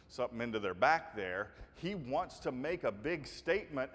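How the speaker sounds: ordinary delivery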